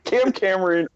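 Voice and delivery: high-pitched, exasperated protest; indignant objection. Real, not acted. Co-host shooting down the idea of hiring Cam Cameron, indignant. Indignantly rejecting a suggested coaching hire.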